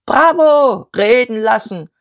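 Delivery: loud, high bravo, then insistent demand; cheering shout. A narrator's reading. Nationalist men backing Diederich, shouting.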